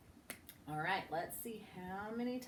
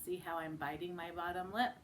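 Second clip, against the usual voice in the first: ordinary talk, nothing marked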